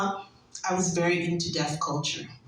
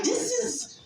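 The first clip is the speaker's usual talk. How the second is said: high, fast squealing dismay; hammed-up disappointed squeal, much higher; mock despair; 13 semitones higher than usual. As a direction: shriek out the letdown in a comic panic. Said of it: "I was so disappointed".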